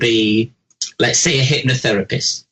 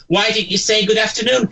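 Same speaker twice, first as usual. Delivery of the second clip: mock pedantic manager; 7 semitones higher than usual. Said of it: "they say to you".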